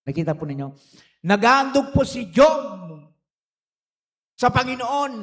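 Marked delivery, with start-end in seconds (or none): 1.2-5.1 emphatic preaching. Real, not acted. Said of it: "preaching emphasis".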